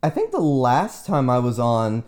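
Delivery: ordinary talk, nothing marked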